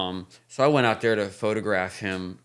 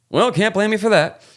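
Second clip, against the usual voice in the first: high, louder mock [name] voice; imitating Luke; 12 semitones higher, 8 dB louder than usual. Luke shrugging off the blame, in a high mock voice. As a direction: toss it off in a high teasing voice. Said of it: "He goes".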